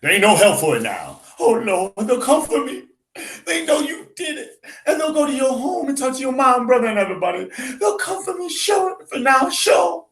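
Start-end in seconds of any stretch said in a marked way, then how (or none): 1.4-10.1 high, half-laughing despair; panicked Bessie. Acted. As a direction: hysterical girlfriend fearing the police. Teacher voices panicked Bessie.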